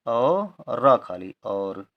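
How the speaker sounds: each letter drawn out very slowly; sounding out slowly. Shown as a demonstration. Teacher spelling और for pupils, very slow. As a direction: spell the word aloud, letter by letter, unhurried. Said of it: "औ से औरत वाला और".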